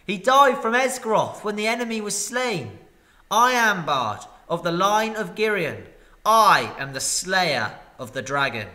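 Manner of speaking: loud, ringing claim of his name; proud declaration. Acted. Bard declares himself the dragon slayer, loud and proud. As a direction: announce himself with fierce pride. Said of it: "he cried".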